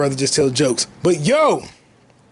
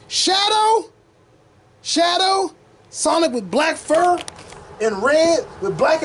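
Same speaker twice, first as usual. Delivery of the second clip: excited; 7 semitones higher, slower than usual. Real, not acted. Hyped about Shadow reveal.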